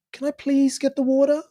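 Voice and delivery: lightly voiced nervous request, rising. Shown as a demonstration. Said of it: "When you're nervous with someone, it's like".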